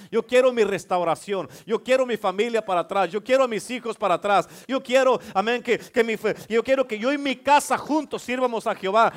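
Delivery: loud, high, impassioned sermon; full-force preaching. Acted. Preacher declares wanting his miracle to church, full-force preaching. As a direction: drive each declaration home with mounting force.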